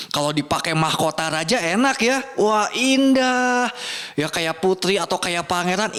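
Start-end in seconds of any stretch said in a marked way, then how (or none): 2.4-3.7 long, drawn-out higher coo of wonder; pretend admiration. Storyteller admiring an imagined king's crown, mock wonder. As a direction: marvel slowly over something lovely.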